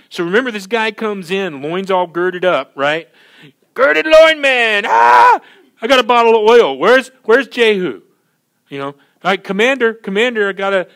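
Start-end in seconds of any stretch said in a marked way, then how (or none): 3.7-8.0 loud, frantic, high-pitched holler; crazy prophet shouting. Acted. Acting out the prophet bursting in. 8.9-10.9 loud, raised-pitch hail; urgent calling out. Acted. Acting the prophet hailing the commander.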